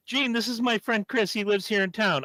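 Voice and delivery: introducing a friend. Retold or imitated. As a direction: present a fan to a star. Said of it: "Eric turns to Gene and goes".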